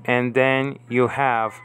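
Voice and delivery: normal talking voice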